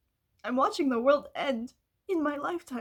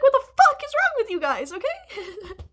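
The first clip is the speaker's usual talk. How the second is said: exasperated outburst; 5 semitones higher, 6 dB louder than usual. Retold or imitated. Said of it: "And I'm like".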